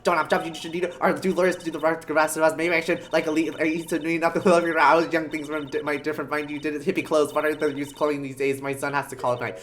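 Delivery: put-on breakneck old-guard tirade; fast, garbled grumbling; rushed rambling rant. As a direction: rattle it off without stopping.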